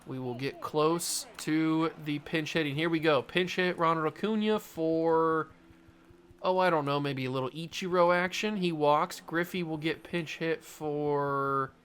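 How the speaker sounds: normal talking voice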